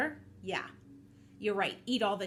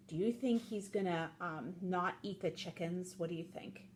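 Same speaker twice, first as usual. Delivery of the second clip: ordinary delivery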